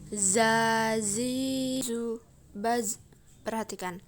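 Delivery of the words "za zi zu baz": "Za, zi, zu, baz" with wasp-like z; long vowels on "Za, zi", buzzing each z. Shown as a demonstration. Teacher shows the za drill, "Za, zi, zu, baz" buzzed. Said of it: "mirip dengan suara dengungan tawon".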